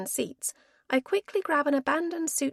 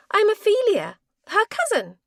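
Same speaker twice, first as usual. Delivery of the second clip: bright introduction; 10 semitones higher, 8 dB louder than usual. Acted. Correcting the friends.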